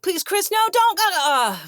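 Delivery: high, loud mock plea; mock pleading. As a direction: beg in comic horror, pitch jumping up. Cast begging Walken in retelling.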